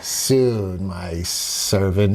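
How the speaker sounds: low, slow, menacing queen voice; sinister mistress. Retold or imitated. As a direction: purr it down low like a villainess. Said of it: "and she says".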